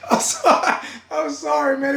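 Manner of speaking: high, breathless sorry through laughter; can't stop laughing while apologizing; laughing apology. Real, not acted. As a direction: still cracking up, saying sorry to the viewers.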